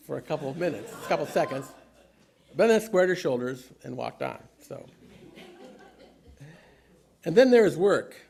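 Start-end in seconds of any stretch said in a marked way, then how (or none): none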